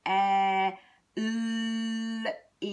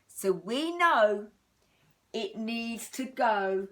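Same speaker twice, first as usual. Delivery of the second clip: ordinary talk, nothing marked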